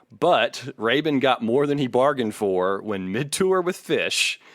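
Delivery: ordinary talk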